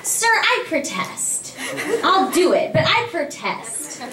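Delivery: high, drawn-out theatrical objection; mock protest. Actor to director while agreeing anyway, theatrical mock protest. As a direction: object grandly while giving in.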